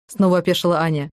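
usual speaking voice

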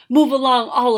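loud, forceful order; old wizard ordering them off, loud; commanding shout. A narrator's reading. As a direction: bark it at the crowd. Wizard orders others away.

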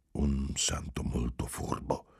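soft breathy whisper to himself; a sly aside, barely voiced; whispered retort. A narrator's reading. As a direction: keep the jab under your breath. Unspoken retort, thought to himself.

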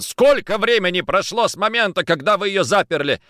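angry. Acted. Angry interrogation after escape.

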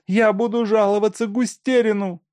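high, whiny complaint. A narrator's reading. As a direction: whine it like she's near tears. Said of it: "плаксивым тоном проговорила Машка". (высоким плаксивым тоном)

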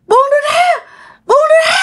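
high-pitched panicked demand; terrified cry. Acted. Scared girl to the demon gripping her hand, high panicked cry. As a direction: cry out in fright to be let go. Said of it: "dì Tư sợ quá trời luôn".

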